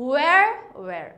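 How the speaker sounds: "where" said as wer with no h sound, repeated; silent h. Shown as a demonstration. Teacher showing students how "where" sounds, no h. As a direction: say "where" twice, no breath of h at the start. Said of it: "এখানে হা উচ্চারণটা কিন্তু হচ্ছে না".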